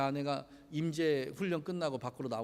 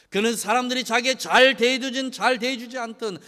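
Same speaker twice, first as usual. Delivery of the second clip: loud, high, impassioned sermon delivery; rising pulpit fervour; full-force preaching; 8 semitones higher, 13 dB louder than usual. Acted. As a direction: preach it out to the congregation. Preaching climax.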